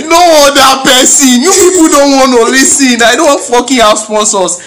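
high, loud exasperated rant; mock outrage.

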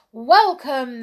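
loud, high royal greeting; booming welcome from the king and queen; cried welcome. A narrator's reading. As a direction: call it out gladly to the heroes. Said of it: "they cried".

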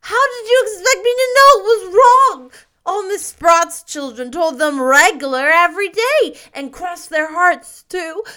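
put-on high, loud, wailing child voice; stormily crying boy. A narrator's reading. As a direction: protest and apologize through childish sobs. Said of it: "cried stormily".

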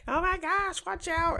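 very high squealed warning at normal volume; hammed-up, high-pitched horror-viewer cry; mock scream. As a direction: pretend to scream at the characters on screen. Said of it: "You're screaming at them".

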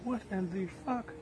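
high, slow, hushed shocked onlooker; mock stunned crowd. Voicing the base crowd staring at him, slow and hushed. Bar crowd's look voiced in story.

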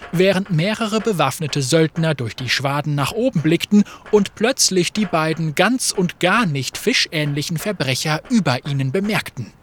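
plain reading voice

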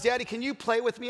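a hint of a little kid asking Daddy; Piper. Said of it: "the Piper goes".

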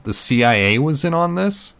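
high, loud, incredulous question; wide-eyed surprise. A narrator's reading. Narrator learns who is tracing them, astonished. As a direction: climb in pitch, astonished, to the end. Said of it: "My eyes widened".

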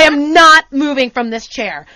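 loud, firm refusal; defiant. Retold or imitated. Customer to man shoving him at bar, loudly defiant. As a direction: dig in, stressing the refusal. Standing ground against pushy man.

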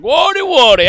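loud, high-pitched caricature holler. Retold or imitated.

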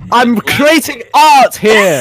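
mock diva protest.

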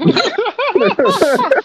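loud high-pitched laughing fit; burst of laughter. Real, not acted. Host cracking up at the bad accent, loud laughter. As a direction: crack up uncontrollably.

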